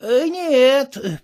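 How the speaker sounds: lightly voiced mumbling old man; toothless old man mumbling. A narrator's reading. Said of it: "шамкает старичок".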